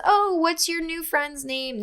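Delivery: ex-friend's girl fishing for the new friend's name, mock sweet. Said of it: "She goes".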